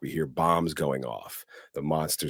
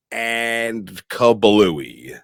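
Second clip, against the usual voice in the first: drawn-out kablooey; 5 semitones higher, 7 dB louder, much slower than usual. Pretend. Telling a cohost about the blast, a drawn-out comic kablooey. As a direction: stretch kablooey out slowly, higher and louder. Comic sound word for the explosion.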